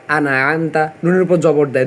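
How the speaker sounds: ordinary delivery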